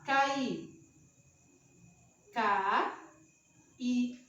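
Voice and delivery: "K I" read as letters, then split K, pause, I; spelling out syllables. Shown as a demonstration. Teacher splitting syllables on the board, pausing. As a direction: separate "K" and "I" with a clear gap. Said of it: "Se eu for separar".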